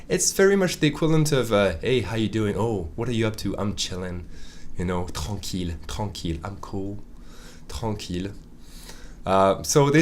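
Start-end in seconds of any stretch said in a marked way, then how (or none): 1.8-8.3 soft, relaxed chill talk; laid-back chat. Pretend. Teacher acting out a relaxed casual greeting.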